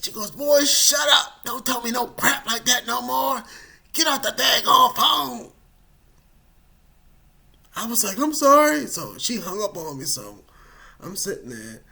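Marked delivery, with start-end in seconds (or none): 0.3-5.5 mother barking him off the phone; furious mom. Retold or imitated. Mom fed up with her son, loud shout. 8.2-8.9 high, loud apology; loud sheepish sorry. Retold or imitated. Son apologizing to his angry mom, loud sheepish.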